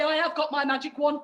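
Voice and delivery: normal talking voice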